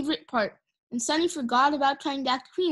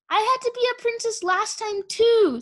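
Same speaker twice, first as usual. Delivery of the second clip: put-on high-pitched grumbling young dragon; complaining; 10 semitones higher, 3 dB louder than usual. A narrator's reading. Starflight objects to playing princess again, high-pitched complaint. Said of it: "Starflight observed".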